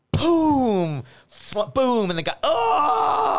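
high, ecstatic cries of boom; excited retelling. Retold or imitated. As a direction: relive the smash at full pitch. Bottle smash retold.

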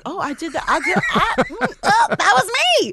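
giggling, pitch jumping way up; laughing at herself. Retold or imitated. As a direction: laugh through the realization. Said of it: "I was like".

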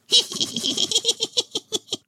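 very high rapid monkey titter; chattering squeaky monkey laugh. A narrator's reading. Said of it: "chattered the creature".

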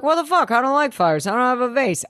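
high-pitched Boston grouch; Boston accent. Retold or imitated. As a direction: complain in a rough Boston accent. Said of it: "he's from Boston in my mind".

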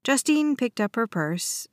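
plain reading voice

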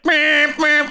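high, slow mock horn beeps "meep"; car horn beeping. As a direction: imitate a car horn with your voice. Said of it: "Everyone overtaking you, just like".

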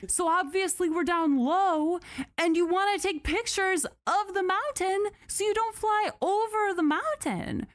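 high, rising disbelief; climbing exasperation ending in a high what; exasperated. Real, not acted. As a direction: cry out in disbelief at the ruling. Exasperated rant on the flight route.

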